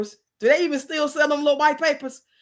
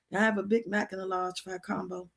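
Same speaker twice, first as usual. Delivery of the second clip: barely audible, much softer, words hardly coming out; mock faint whisper; 4 semitones lower, 10 dB quieter than usual. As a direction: mouth the order with almost no sound. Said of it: "But I was whispering".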